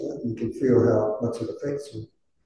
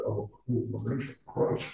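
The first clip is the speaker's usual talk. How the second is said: hushed, mock impressed oh cool; whispered awe; 2 semitones lower, 12 dB quieter than usual. Student whispering to himself at famous names, hushed. Student's imagined awed reaction.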